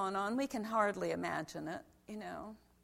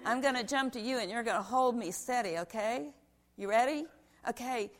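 ordinary talk, nothing marked

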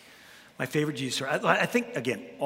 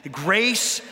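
slow, swelling preacher's emphasis; preaching with rolling waves of grace; 2 semitones higher, 3 dB louder, slower than usual. Real, not acted. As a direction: stretch out grace like a sermon. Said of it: "so I might be preaching".

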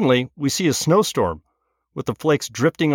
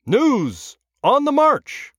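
booming, much higher newsreel announcer; old radio announcer; 13 semitones higher, much slower than usual. Acted. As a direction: proclaim it like a newsreel opener. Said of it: "The voice rises again".

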